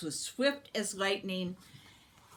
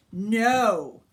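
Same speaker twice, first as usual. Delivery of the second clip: sharp, much louder refusal; firm loud no; 3 semitones higher, 10 dB louder than usual. A narrator's reading. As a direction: slam the door on the lie. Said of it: "she saw at once how they lied".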